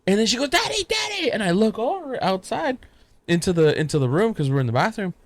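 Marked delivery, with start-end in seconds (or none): 0.5-1.3 very high child imitation; excited little daughter. Daughter in her towel calling dad, excited child voice. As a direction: call out high like a small child.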